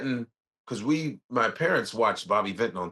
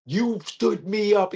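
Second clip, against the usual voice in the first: hammed-up scorned date, slow and high; slow, stagey "You stood me up"; mock angry accusation; 8 semitones higher, slower than usual. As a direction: play the jilted girlfriend, drawn out. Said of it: "she comes up and she goes".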